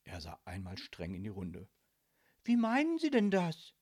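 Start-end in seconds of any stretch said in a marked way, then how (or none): 2.4-3.7 high, louder disbelieving question; taken aback, voice raised; incredulous. A narrator's reading. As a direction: ask as if you can't believe it. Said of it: "fragte Lilith ungläubig".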